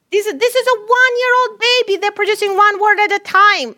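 excited. Real, not acted. Marvelling at a baby's talk.

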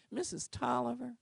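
usual speaking voice

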